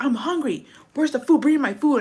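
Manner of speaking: high-pitched mock tantrum; imitated demanding yell. Hungry diner demanding to be served, mock yelling. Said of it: "why are you yelling".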